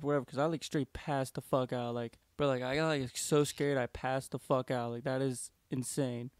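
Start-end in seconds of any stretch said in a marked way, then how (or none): none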